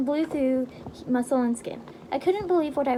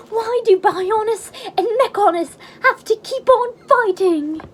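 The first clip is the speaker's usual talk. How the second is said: high, loud hammed-up cry; dramatic shout; 9 semitones higher, 11 dB louder than usual. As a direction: belt it like an anime hero. Quoting a game hero's cry.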